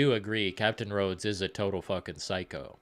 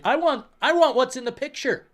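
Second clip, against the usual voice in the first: whiny demand; 13 semitones higher, 5 dB louder than usual. Pretend. Whining demand for pictured burger.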